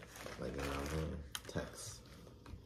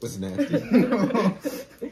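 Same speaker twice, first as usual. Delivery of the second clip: high, loud and very slow, laughing through it; laughing in disgust; 14 dB louder, much slower than usual. Real, not acted. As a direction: drag it out, cracking up. Disgusted by the flavor.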